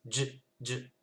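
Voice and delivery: Dj, dj: consonne bloquée, sans voyelle; stopped dj. Shown as a demonstration. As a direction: comme une coupure technique. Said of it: "comme s'il y a un petit problème technique". (dj coupé net)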